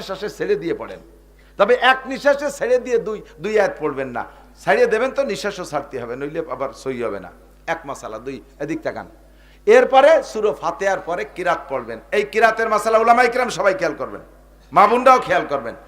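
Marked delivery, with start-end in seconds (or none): none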